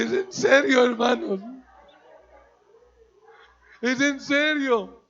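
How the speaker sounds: loud, high, amazed question through laughter; loud laughing shock; laughing disbelief. Retold or imitated. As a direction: act stunned and half-laughing. Shocked team asking.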